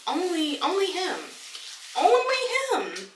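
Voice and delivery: wife marveling at her carefree husband; amused disbelief. Real, not acted. Disbelieving marvel.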